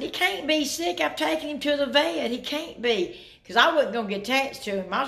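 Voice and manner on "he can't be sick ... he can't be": high-pitched, mildly indignant quote of herself; retold insistent protest. Wife tells husband about taking the cat to the vet, high retold protest. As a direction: protest in a raised pitch, as if retelling it.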